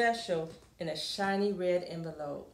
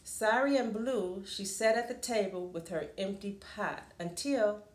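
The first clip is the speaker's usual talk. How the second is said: ordinary delivery, nothing marked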